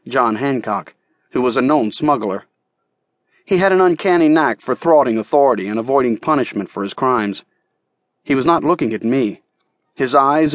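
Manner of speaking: ordinary delivery, nothing marked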